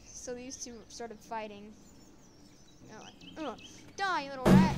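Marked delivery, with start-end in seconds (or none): 3.4-4.8 raised voice building to a shout; acted battle cry. Fighter attacking the rat, angry shout. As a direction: end on a loud, angry yell.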